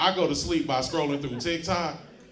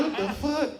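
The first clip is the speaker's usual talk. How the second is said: mock surprise; 10 semitones higher than usual. Confused reaction.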